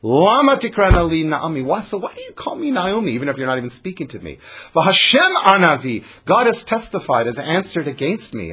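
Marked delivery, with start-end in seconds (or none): 0.0-7.3 higher and louder, indignant; sharp, bitter. Acted. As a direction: demand why they still say her name. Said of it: "Naomi is a little sharp".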